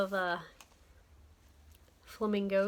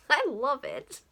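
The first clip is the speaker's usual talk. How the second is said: laughing; 6 semitones higher, slower than usual. Real, not acted. Laughing over the ornament.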